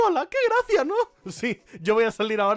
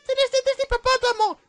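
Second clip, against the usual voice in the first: put-on higher childish taunt; mock captivating voice; 5 dB louder than usual. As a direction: tease in a put-on high voice. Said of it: "Voz cautivadora".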